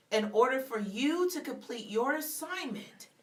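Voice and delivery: ordinary talk, nothing marked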